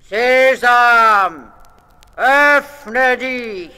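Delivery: loud, high commanding shout; robber chief's call. A narrator's reading. Robber chief ordering the cliff open, commanding shout. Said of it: "hat gerufen".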